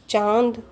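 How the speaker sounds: चांद with the long vowel through the nose; nasalised vowel. Shown as a demonstration. Teacher giving examples of nasal sounds, nasalised चांद. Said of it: "वही अनुनासिक ध्वनियाँ कहलाती हैं".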